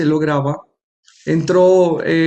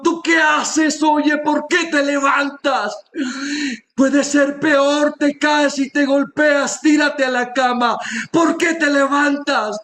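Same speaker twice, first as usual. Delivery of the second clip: shouting doctor; 9 semitones higher, slower than usual. Retold or imitated. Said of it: "me pega un grito".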